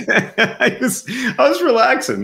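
high, laughing through words. Real, not acted. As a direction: laugh while explaining. Laughing at mic joke.

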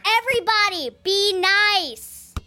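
high, loud order to the group; exasperated shout. Acted. Girl breaks up babysitter and elf's squabble, shouting. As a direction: shout them down to stop the bickering.

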